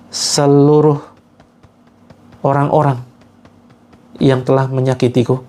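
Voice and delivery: pleading prayer intoned phrase by phrase; earnest guided prayer. Acted. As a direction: lead the group in begging God for strength to forgive. Leads a forgiveness prayer.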